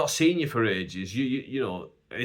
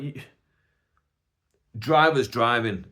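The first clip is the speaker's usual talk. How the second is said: ordinary talk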